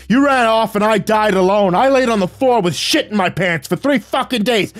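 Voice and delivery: high, loud furious shriek. Acted. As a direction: scream the accusation at the top of the lungs. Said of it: "He shrieked".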